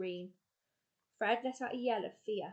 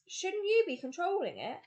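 high, loud panicked question; panicky; 10 semitones higher, 5 dB louder than usual. A narrator's reading. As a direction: panic rising in the voice. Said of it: "Fred's voice sounded panicky".

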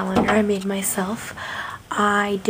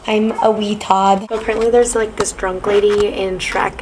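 usual speaking voice

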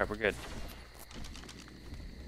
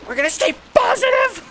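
much higher, louder shout of forced optimism; shrieked forced cheer; 9 dB louder than usual. Real, not acted. Gamer yells at himself after nearly dying, a shrieking shout. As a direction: scream the positivity through the panic. Nearly killed by level twenty.

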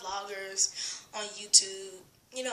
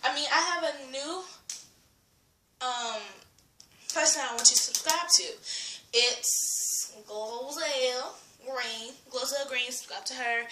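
ordinary delivery

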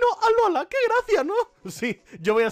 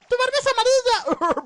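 higher, teasing squeak; mock captivating voice; 6 dB louder than usual. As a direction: poke fun in a high voice. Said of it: "Voz cautivadora".

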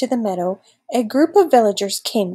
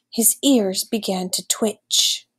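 plain reading voice

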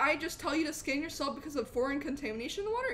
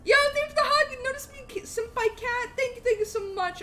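high-pitched excited streamer greeting; gushing thanks; 9 semitones higher, 5 dB louder than usual. Real, not acted. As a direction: burst in loud and high, then settle slightly. Streamer thanks viewer for hug.

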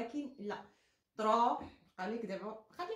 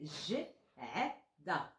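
spelling slowly; 6 semitones lower, 4 dB quieter, much slower than usual. Shown as a demonstration. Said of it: "ج-، صافي".

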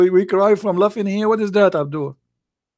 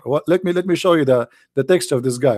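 ordinary delivery

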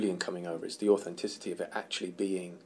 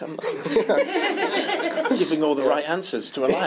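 loud, high, laughing through the words; laughing retelling; 10 semitones higher, 7 dB louder, slower than usual. Retold or imitated. Retelling an accidental lie to a fellow actor, laughing. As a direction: crack up while telling it.

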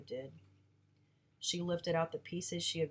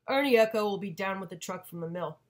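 louder curt father; curt answer; 2 semitones higher, 10 dB louder than usual. Acted. Father answering mom about the truck, curt and loud. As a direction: shut the question down loud.

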